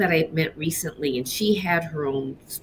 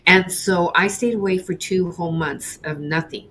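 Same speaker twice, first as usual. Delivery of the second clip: ordinary delivery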